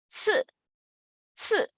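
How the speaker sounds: 刺 with a high, falling fourth tone; fourth-tone demo. Shown as a demonstration. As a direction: say 刺 high, then drop it sharply in pitch. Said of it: "C I with the fourth tone".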